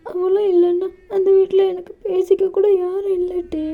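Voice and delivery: high, pleading voice with crying breaks; tearful young lover. Acted. JK pleads with Dev not to leave, high and tearful. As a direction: beg him not to leave you, close to sobbing.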